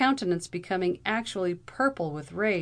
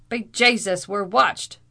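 loud, startled Irish outburst; sudden exclamation; 3 semitones higher, 8 dB louder than usual. A narrator's reading. Captain spotting a man approaching, sudden loud exclamation. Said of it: "suddenly exclaimed the captain".

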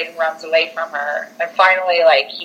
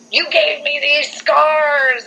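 high, slow mock wail; angry ghost; 9 semitones higher, slower than usual. Ghost showing her cut wrists, high drawn-out mock cry. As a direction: drag out the accusation like a haunting ghost. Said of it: "A ghost is".

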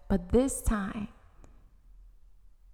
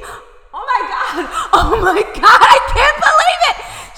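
screaming, far higher and louder, laughing through it; excited screaming; 15 dB louder than usual. Acted. Girl screaming for joy at the Mountain of Glory. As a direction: shriek with laughing disbelief. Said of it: "She started screaming".

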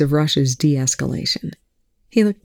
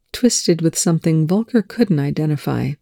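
ordinary delivery

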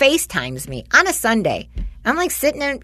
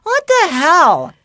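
high, loud, flustered rush of excuses; a teen scrambling to explain; 8 semitones higher, 6 dB louder than usual. Retold or imitated. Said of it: "And he's like".

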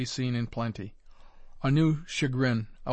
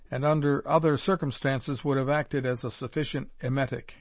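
usual speaking voice

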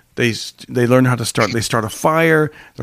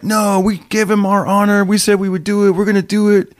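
higher-pitched, lightly voiced righteous boy; earnest protest, imitated; 7 semitones higher than usual. Boy refusing friend's cheat plan, earnestly imitated. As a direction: insist on honor, mock earnest.

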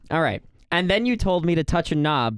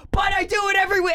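much higher, fast shouted whine; mocking impression of cohost; faster than usual. Imitating cohost insisting on the wrong knob, high fast whine. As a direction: squeal it like a stubborn kid. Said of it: "And you're like".

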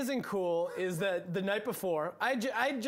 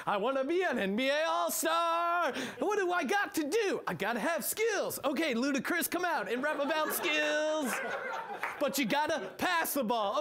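mock musical hero; 6 semitones higher than usual. Mocking the musical's plot.

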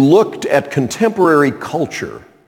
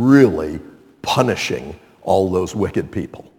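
low, measured voice of God; God answering; 6 semitones lower, 4 dB quieter than usual. Acted. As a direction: announce the plan calmly and deliberately, pitched low. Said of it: "And God says".

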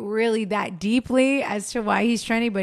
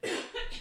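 sudden sharp cough; choking cough; 12 semitones higher, 13 dB quieter than usual. Real, not acted. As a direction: cough as if something went down wrong. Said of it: "Sorry, I'm choking".

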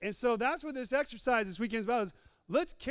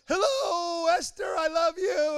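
higher, louder yelled greeting from a passing car; woman calling from a car, higher and louder; retold yell; 8 semitones higher, 3 dB louder, slower than usual. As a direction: call it out across the lot, higher and louder. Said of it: "she yelled".

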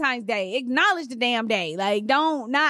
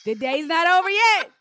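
higher-pitched, rising mock protest; mocking husband's excuse; 5 semitones higher than usual. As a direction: mimic a defensive husband, pitched up.

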